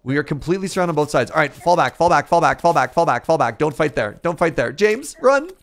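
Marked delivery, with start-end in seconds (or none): 1.3-3.6 loud urgent retreat call; shouting orders over battle. Real, not acted. Retreat orders under attack. 3.6-4.7 urgent warning. Real, not acted. Urging teammate in battle. 4.7-5.5 high, loud call to run; urgent call. Real, not acted. Calling teammate to flee.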